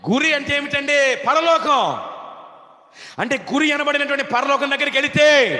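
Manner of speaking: high, loud shouted sermon; fervent preacher's shout, drawn high; full-force preaching. Real, not acted. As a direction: shout it out to the whole congregation.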